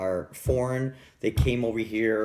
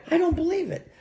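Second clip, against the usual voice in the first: high-pitched retold disbelief; mock disbelief; faster than usual. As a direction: squeak it like an amazed kid. Acting out his childhood disbelief.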